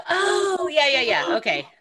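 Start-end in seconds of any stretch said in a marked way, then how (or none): 0.0-0.7 gasping. Real, not acted. Shocked by threat, gasping.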